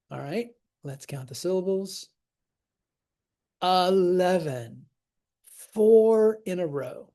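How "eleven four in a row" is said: louder, higher, slowed-down announcement; excited. Real, not acted. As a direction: announce the streak with delight. Thrilled by eleven-syllable streak.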